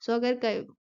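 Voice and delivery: ordinary delivery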